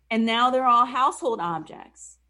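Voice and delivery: usual speaking voice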